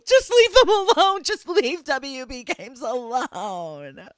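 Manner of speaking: high tearful shriek, then fading; Chris Crocker. Retold or imitated. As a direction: beg through laughing sobs. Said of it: "that's my best Chris Crocker".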